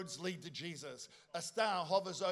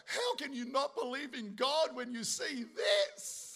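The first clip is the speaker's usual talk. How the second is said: retold tearful awe; 5 semitones higher, slower than usual. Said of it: "'cause I'm crying".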